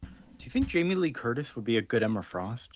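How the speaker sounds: ordinary talk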